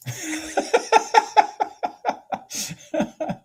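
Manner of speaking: airy high-pitched laugh; high breathy laugh. Real, not acted. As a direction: laugh at your own joke. Laughs at own joke.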